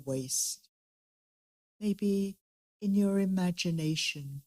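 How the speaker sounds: soft, unhurried meditation guide; guided meditation. Acted. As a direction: gently lead the group inward.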